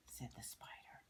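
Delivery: usual speaking voice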